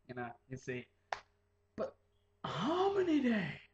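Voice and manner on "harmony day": higher, louder, slow cheer. Real, not acted. Cheering Harmony Day to viewers, excited. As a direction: cheer it like a holiday.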